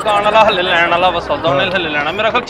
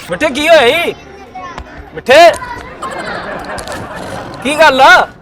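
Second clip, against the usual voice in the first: calling out; 5 semitones higher than usual. Acted. Calling to silent friend.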